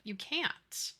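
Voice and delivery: ordinary talk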